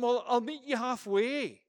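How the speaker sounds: high, loud acted reply; mock call back. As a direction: call it back to a rescuer, hammed-up. Acting absurd reply to the rescuer.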